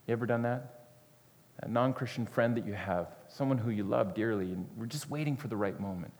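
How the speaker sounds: soft, intimate sermon voice; gentle confiding preacher; hushed preaching. Acted. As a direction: draw the listeners close, quietly.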